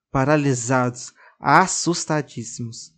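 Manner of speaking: usual speaking voice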